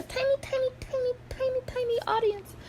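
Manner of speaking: very high, small playful voice; tiny squeaky voice. Shown as a demonstration. Vlogger joking about a tiny audience, tiny high voice.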